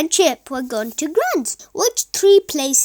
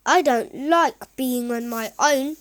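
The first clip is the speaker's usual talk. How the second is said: ordinary delivery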